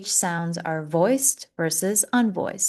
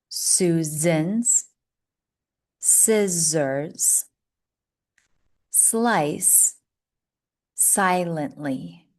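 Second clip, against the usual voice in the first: word by word; much slower than usual. Shown as a demonstration. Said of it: "One more".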